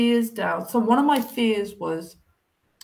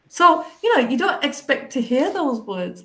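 normal talking voice

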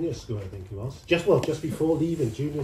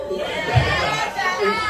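chipmunk voice; 5 dB louder than usual. Pretend. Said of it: "can you still do a chipmunk?".

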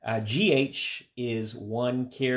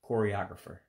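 ordinary talk

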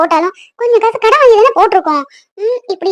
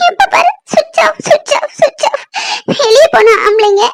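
tearful complaint, pitch climbing high as she sobs; crying; 6 semitones higher than usual. Acted. Wife to husband who switched off his phone, crying. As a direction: sob out the grievance to him, voice rising. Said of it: "அழாத பூமாரி".

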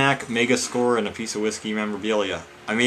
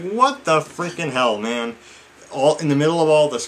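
exasperated; 7 semitones higher, 6 dB louder than usual. Real, not acted. Ranting to viewers about the dead mouse, exasperated.

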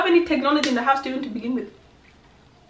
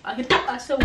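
usual speaking voice

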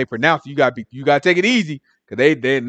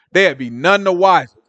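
ordinary talk, nothing marked

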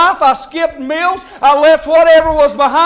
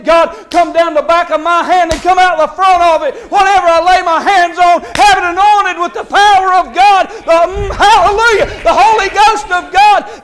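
fervent crying out; 3 semitones higher, 4 dB louder than usual. Acted. Preacher re-enacts his morning prayer for anointed hands, fervent shouting. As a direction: call down God's power at full force.

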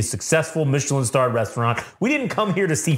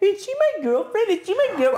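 a much higher pleading voice; whiny high voice. Retold or imitated. Carmy asking Fact about her, mock whiny high voice. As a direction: ask it high like a lovesick kid. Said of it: "he's asking Fact".

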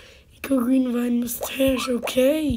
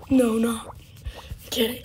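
usual speaking voice

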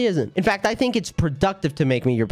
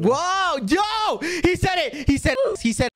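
high excited shout; 12 semitones higher, slower than usual. Real, not acted. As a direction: yell in gleeful triumph. Bad Boy Halo swore.

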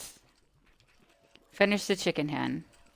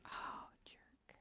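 whispered; 22 dB quieter than usual. Real, not acted. Whispered insult at creature.

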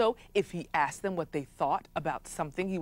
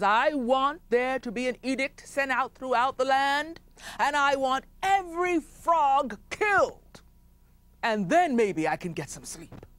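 chief commanding; 7 semitones higher than usual. Acted. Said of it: "He says".

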